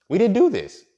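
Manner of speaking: high, loud insistence; insistent shout. Retold or imitated. To the customer blaming the valet, a loud insistent denial.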